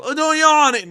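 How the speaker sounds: high shrill scolding shout; mock scolding shout. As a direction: yell it like a nagging parent. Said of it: "because they both".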